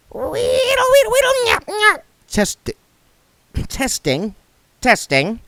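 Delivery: squeaky imp noise. A narrator's reading. The imp replays its memory, very high squeaky. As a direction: mimic a tiny squealing machine.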